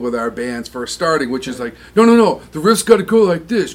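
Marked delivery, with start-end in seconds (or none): 2.0-3.7 imitating a bossy teen, higher and louder; teenage bandmate insisting. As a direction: push your riff idea like a stubborn sixteen-year-old. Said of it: "talk the old way we spoke when we were sixteen".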